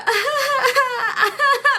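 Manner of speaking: high-pitched imitated giggling fit; mock laughter. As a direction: mimic her laughing down the phone. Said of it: "like, laughing".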